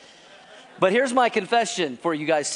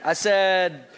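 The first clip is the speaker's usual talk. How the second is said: ordinary talk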